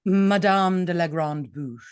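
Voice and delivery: "Madame de la Grande Bouche" drawn out, in French manner; slow, grand French "Madame de la Grande Bouche"; French pronunciation. Shown as a demonstration. Actress correcting host, "Madame de la Grande Bouche" slow and French. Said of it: "Did I pronounce that correctly".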